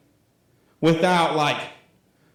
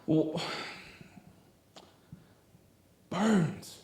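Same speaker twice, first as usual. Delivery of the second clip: much softer, breathy near-whisper on burdens; hushed emphasis; 12 dB quieter, much slower than usual. Acted. Youth pastor letting the key word land, hushed. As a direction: drop almost to a whisper.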